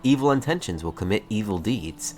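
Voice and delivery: usual speaking voice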